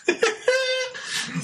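very high squeal of laughter; high-pitched laugh. Real, not acted. Laughing at wishing for a rabbi in hand, high squeal.

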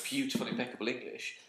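ordinary talk, nothing marked

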